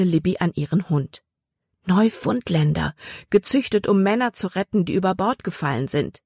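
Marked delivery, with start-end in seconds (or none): none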